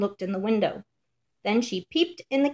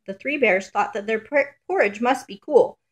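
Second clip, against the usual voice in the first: ordinary delivery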